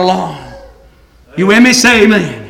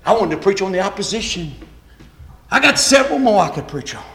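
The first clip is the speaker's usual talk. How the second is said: ordinary talk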